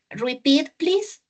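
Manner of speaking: ordinary talk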